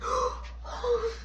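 soft, high acted gasps; breathless gasping. Kid left to recover, soft acted gasping. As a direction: pull in air in weak gasps. Still breathless after choking.

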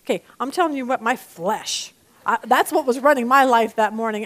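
ordinary talk, nothing marked

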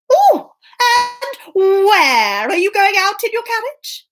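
high, slow, drawn-out question; keenly curious Puss. Acted. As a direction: feign casual interest, stretching "where". Puss pricks up his ears.

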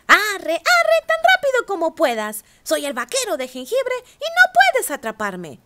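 high, loud taunting sing-song; teasing cookie voice. A narrator's reading. Gingerbread cowboy taunting the ranchers, high loud sing-song. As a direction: taunt them, high and cocky. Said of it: "se rió y dijo".

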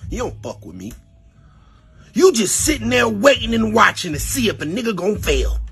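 normal talking voice

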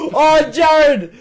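high, loud, slow wail; excited wail. Real, not acted. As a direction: wail over the near miss. Near miss reaction.

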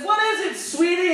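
high, sing-song mother's voice; sweet mom. Acted. Mom answering her daughter, high and sugary. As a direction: coo it like a doting mom. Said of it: "her mom says".

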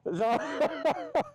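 helpless, high-pitched belly laughter through the words; real chuckling fit. Real, not acted. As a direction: crack up and struggle to finish the sentence. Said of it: "it does make me chuckle".

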